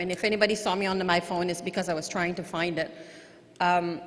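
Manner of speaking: ordinary talk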